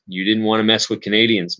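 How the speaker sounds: normal talking voice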